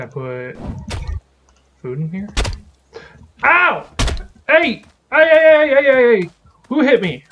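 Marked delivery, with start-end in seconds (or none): 3.4-7.2 loud startled shouts; startled yelling. Real, not acted. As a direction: yell out at the attacker. Attacked by mob in game.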